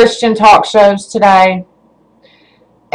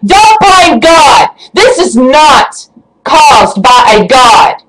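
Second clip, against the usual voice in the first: slow, forceful indignant shout; angry insistence; slower than usual. Real, not acted. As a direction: hammer it out slowly in real anger. Angry rant, shouted slow denial.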